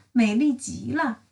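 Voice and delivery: usual speaking voice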